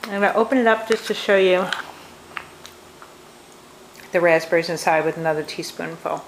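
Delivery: talking with mouth full; words round a bite of food. Real, not acted. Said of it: "I'm sorry, my mouth is full".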